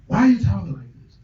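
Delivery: retold alarm. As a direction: act out her worried demand on the phone. Retold phone call, alarmed woman replies.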